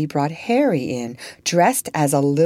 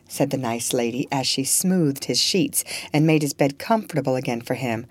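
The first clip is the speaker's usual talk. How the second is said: plain reading voice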